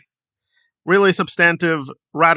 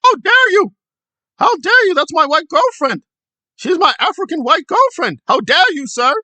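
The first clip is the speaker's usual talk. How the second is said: high, loud hammed-up outrage; mock angry Streets; 11 semitones higher, 5 dB louder than usual. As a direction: parody his fury, shrill and loud. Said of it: "Streets got very angry".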